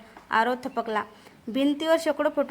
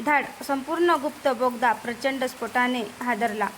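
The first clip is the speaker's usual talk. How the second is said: usual speaking voice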